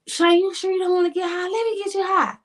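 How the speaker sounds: lightly voiced friend tempting her to get high; pushy friend offering weed.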